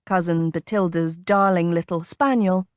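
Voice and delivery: ordinary delivery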